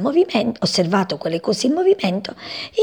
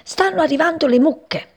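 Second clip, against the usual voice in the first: high-pitched alarm call; urgent cry; 9 semitones higher than usual. A narrator's reading. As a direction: warn them of the coming danger. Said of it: "gridò una voce".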